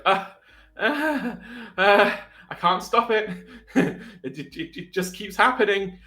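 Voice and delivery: high, loud, stammering distress; flustered panic. Acted. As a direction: stammer helplessly, voice jumping up. Game master voices panicking creature.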